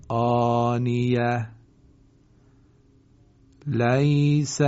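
slow, melodic Quran chant with long held vowels; measured tajwid-style recitation. Acted. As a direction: recite slowly, drawing out each word.

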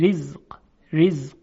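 thin, delicate ر in «رزق»; light, correct r before i in «رزق»; ترقیق. Shown as a demonstration. («رزق» با رای نازک و صحیح)

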